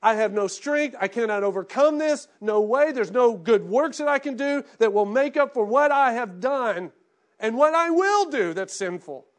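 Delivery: loud pleading outpouring; loud desperate confession. Retold or imitated. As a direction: cry out your helplessness at full voice. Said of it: "When you realize that".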